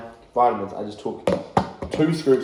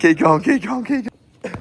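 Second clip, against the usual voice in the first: cheering on; 11 semitones higher, 7 dB louder than usual. Real, not acted. Coach cheering on sled pull.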